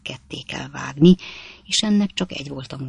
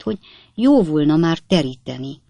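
ordinary delivery

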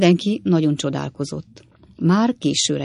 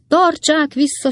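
high, drawn-out cry of alarm; women crying out, slow and high; sikoltozás; 11 semitones higher, 4 dB louder than usual. A narrator's reading. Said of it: "sikoltozták a nők valamennyien". (magas, elnyújtott, rémült kiáltás)

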